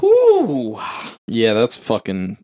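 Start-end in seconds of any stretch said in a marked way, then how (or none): 0.0-0.8 loud, high-pitched whew; shocked whew. Real, not acted. Reacting to disturbing trivia.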